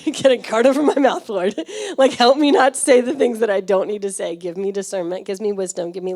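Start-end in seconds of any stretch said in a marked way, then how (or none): 0.0-1.6 laughing prayer. Retold or imitated. Laughing mock prayer, louder.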